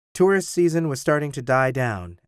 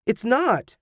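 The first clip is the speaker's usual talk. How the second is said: high-pitched, startled protest; urgent high objection; 11 semitones higher than usual. A narrator's reading. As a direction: blurt out a denial, voice leaping up. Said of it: "Tim protested".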